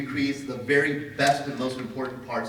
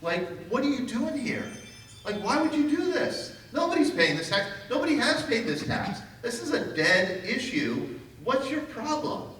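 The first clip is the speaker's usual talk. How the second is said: much higher, hammed-up outraged farmer; mock indignant Miller; 10 semitones higher than usual. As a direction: play up the baffled anger of a man who won't pay. Retold heated quarrel with the marshal.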